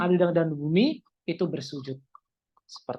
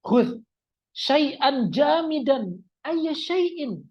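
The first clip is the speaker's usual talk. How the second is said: high, slow, deliberate Arabic request; example request to friend; 9 semitones higher, 4 dB louder, much slower than usual. Shown as a demonstration. Repeating the sample request to a friend, high deliberate delivery. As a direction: draw out the Arabic phrases in raised pitch. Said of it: "saya ngomong sama teman saya".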